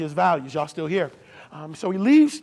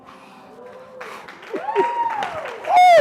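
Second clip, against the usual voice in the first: very high, drawn-out whoop swelling louder; 7 dB louder than usual. Real, not acted. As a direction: let out a long excited squeal. Long high whoop after the point.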